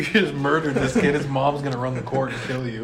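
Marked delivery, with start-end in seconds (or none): none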